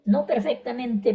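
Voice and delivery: ordinary delivery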